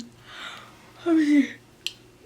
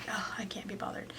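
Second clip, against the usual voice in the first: breathy whispered shrug of a line, much softer; lazy whisper; 3 semitones lower, 11 dB quieter than usual. Retold or imitated. Telling the camera about the dead car, whispered and lazy. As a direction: whisper it like you can't be bothered to get up. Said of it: "I'm just like".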